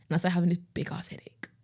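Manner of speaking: hushed whisper, nearly no voice; whispered recollection. Retold or imitated. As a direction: breathe it out under the breath as the headache sets in. Recalling the start of a migraine.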